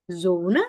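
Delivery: "Zone" with a buzzing Z onset. Shown as a demonstration. Teacher contrasting two words, buzzed Z in "Zone". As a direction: voice the initial sound of "Zone". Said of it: "Yang satu kayak Z".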